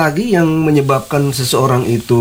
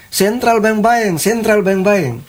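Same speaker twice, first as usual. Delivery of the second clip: imitated calling out; 8 semitones higher, slower than usual. Dying patient repeating words instead of the creed, imitated calling out. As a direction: stretch it out like a fare-seeker's call. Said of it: "dia bilang".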